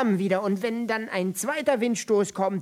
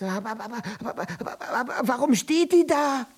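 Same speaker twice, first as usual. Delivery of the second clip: higher, louder flustered outburst; 4 semitones higher, 3 dB louder, faster than usual. Acted. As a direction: splutter it out.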